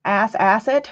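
normal talking voice

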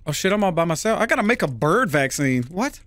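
usual speaking voice

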